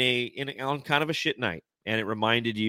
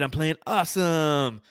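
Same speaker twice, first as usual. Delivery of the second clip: higher, drawn-out gleeful boast; mock enthusiasm; 5 semitones higher than usual. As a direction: imitate a suddenly carefree player. Mimicking traded player playing well.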